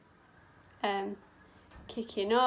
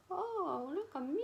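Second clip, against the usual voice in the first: high, soft put-on coo; playful self-admiration; 6 semitones higher, 5 dB quieter than usual. Makeup artist adding highlight to the colours, playful high coo. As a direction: coo it high and lightly, showing off.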